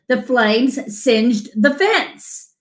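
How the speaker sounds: ordinary delivery, nothing marked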